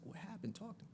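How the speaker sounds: very soft imitation of mom; calming mother. Mom calming her crying son, hushed retold question. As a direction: drop to a near-silent, coaxing voice. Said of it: "she's trying to calm me down".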